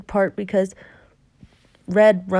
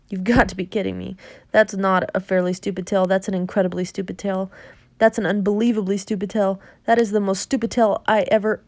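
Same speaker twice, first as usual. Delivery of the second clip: 1 fast exasperated rant; faster than usual. Acted. Jack rants at the giant.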